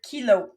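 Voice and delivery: kilo said clearly, falling; teacher models kilo for the class to repeat; model word. Shown as a demonstration. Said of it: "I say it and you say it".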